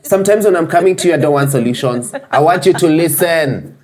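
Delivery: high, loud, exasperated pleading; upset wife impression. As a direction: rant as the frustrated wife. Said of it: "This time, I try".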